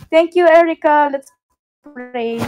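usual speaking voice

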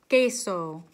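queso said clearly, KAY-so, loud model; model word queso. Shown as a demonstration. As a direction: model queso slowly for the class. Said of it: "Everybody say queso".

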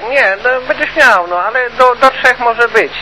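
normal talking voice

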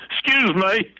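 tipsy Jethro apologizing to a door jamb; grinning country-boy apology. Retold or imitated. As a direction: say it with a big dopey grin to a door. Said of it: "put a big grin on my face and said".

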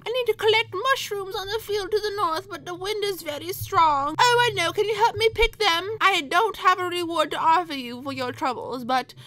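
high put-on granny voice; high-pitched old lady asking for help. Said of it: "a very happy old lady".

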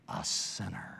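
hushed drop. Acted. Preacher telling the church she was a sinner, hushed and low. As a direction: let the word sink to a low hush. Dramatic drop on key word.

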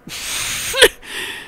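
high voiceless wheeze of laughter; laughing. Real, not acted. Reader reacting to absurd killing, wheezy laugh. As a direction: wheeze helplessly.

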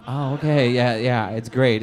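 ordinary talk, nothing marked

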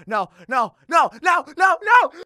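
panicked shout. Acted. Movie Sonic panics as she repeats it, shouting. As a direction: scream in mounting panic.

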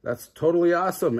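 high, fast excited burst; shouted excitedly. A narrator's reading. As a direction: blurt it out thrilled. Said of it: "Edison shouted".

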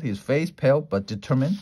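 ordinary delivery

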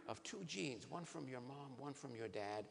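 usual speaking voice

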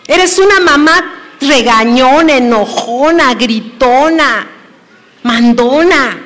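loud scolding list of faults. Acted. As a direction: scold the mother hard. Preacher scolding the mothers, loud.

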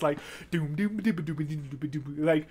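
quiet mouthed bass line doom doom; soft bass imitation. Shown as a demonstration. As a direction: hum the bass softly under your breath. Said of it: "kinda imitating those instruments".

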